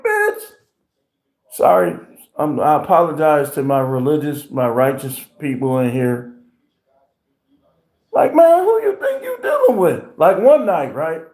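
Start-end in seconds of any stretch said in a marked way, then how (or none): 0.0-0.4 high mock pimp snap. Quoting himself acting like a pimp. 8.1-10.0 mock pimp challenge. Man quoting himself confronting a woman, high mock outrage.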